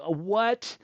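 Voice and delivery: retold disbelief, high, loud and rising; incredulous. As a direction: balk at it as you retell. Retold disbelief at tiny commission.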